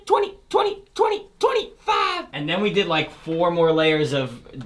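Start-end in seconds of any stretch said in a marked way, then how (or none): 0.1-2.3 a squeaky, sky-high vocal double; super high-pitched. Shown as a demonstration. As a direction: sing it way up in a very high register. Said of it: "did this super high-pitched thing".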